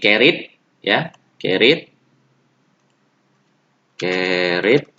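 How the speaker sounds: carried pelan untuk ditiru; carried model word. Shown as a demonstration. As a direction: ucapkan "carried" jelas sebagai /kerit/. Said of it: "yang ada I D di sini". (ucapan contoh "carried")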